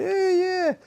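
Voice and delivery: high-pitched mock family cheer; excited cheering. Storyteller mimics a family on a camping trip, excited cheering.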